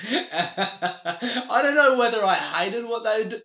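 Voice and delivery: loud, high, drawn-out words through laughter; laughing. Real, not acted. Laughs at strangers overpaying for water.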